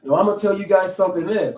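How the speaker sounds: usual speaking voice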